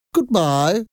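high, rising sign-off; high voice with a cold. A narrator's reading. Strange caller hanging up on the mother, high voice with a cold. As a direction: end the call in a high head-cold voice. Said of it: "had a high voice and talked as if she had a bad cold".

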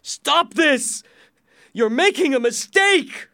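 high, full-voiced desperate shout. A narrator's reading. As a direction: shout at the king to stop. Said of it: "looked at the king and shouted".

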